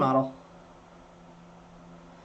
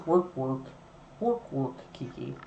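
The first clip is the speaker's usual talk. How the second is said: World of Warcraft peon; 6 semitones lower, 8 dB quieter than usual. Retold or imitated. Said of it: "teach her the World of Warcraft".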